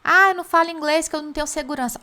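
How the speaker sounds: fast whiny student excuse, pitch up; imitating a student's excuse; mock excuse. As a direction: rattle off the lame excuse quickly. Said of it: "agora você não tem mais desculpa".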